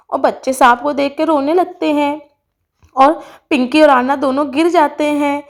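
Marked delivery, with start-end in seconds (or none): none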